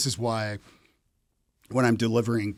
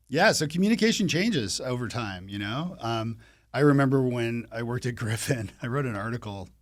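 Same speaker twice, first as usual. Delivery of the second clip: ordinary talk, nothing marked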